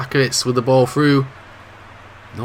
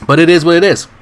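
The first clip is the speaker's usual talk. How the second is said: emphatic shrug; 5 semitones higher, 7 dB louder than usual. Real, not acted. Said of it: "I've still got dry throat".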